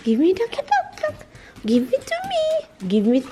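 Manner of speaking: high sing-song coaxing for the dog; squeaky pet-talk. Real, not acted. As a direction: coo at the puppy to bring the toy.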